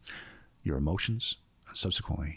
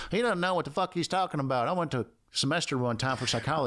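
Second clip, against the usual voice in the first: higher, nasal-free know-it-all sneer; mocking critic voice; 8 semitones higher than usual. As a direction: mimic a smug online commenter. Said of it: "Why'd you do that voice?".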